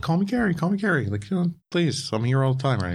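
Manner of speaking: a hammed-up, high pleading plea; mock pleading Gary. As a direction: beg him to use your first name. Gary pleading voiced in story.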